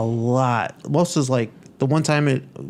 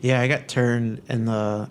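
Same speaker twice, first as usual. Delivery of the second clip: ordinary talk, nothing marked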